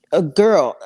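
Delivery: normal talking voice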